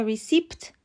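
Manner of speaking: ریسیپت with P and T both sounded; wrong pronunciation. Shown as a demonstration. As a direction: end ریسیپت on "-ipt". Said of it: "هر دو غلط هستن".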